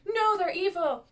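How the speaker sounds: half-acted urgent warning; alarmed kid's protest. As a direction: play the scared boy insisting. Henry voiced in recap.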